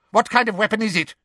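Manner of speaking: fast, high demand; impatient, harsh interrogator. A narrator's reading. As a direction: fire the question sharply. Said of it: "said Umbridge harshly".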